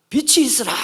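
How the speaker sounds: loud, high divine command; God's creation command. Acted. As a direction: proclaim it forcefully as God creating. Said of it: "말씀하심에".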